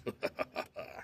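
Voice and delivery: a soft breathy giggle. Acted. Hidden chipmunk giggling softly at Bear. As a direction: giggle quietly from hiding.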